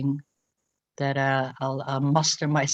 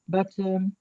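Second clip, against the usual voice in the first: normal talking voice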